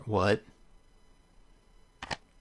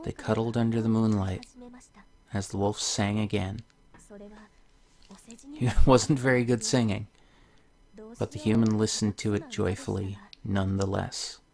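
soft, gentle storybook reading; story narration; 8 dB quieter than usual. Acted. Reading a tale to viewers, soft storybook voice. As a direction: read the fairy tale softly to the viewers. Reads the game's story aloud.

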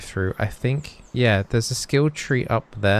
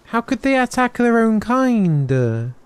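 mock despair; 11 semitones higher, much slower than usual. Player mourning a chicken killed by its kind, hammed-up anguish. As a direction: ham up the grief, drawn out.

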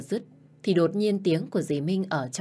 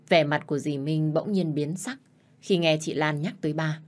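ordinary delivery, nothing marked

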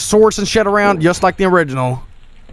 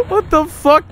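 high laugh tipping into a cry; helpless giggle then disbelief; laughing to tears; 9 semitones higher, slower than usual. Real, not acted. Streamer laughing at the pizza thief, crying with laughter.

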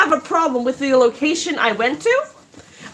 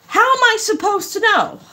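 exasperated retort; 9 semitones higher than usual. Retold or imitated. To the app support, exasperated high-pitched retort. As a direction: throw the question back, fed up. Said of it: "And then I'm like".

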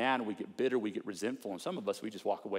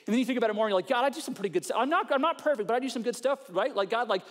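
much higher and louder, smug bragging to God; prideful pray-er; 10 semitones higher, 6 dB louder than usual. Retold or imitated. Pastor mocks a prideful churchgoer bragging to God, high and smug. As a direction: whine it like a self-righteous churchgoer showing off. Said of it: "conclusion that kinda goes like this".

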